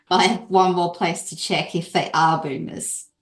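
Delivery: normal talking voice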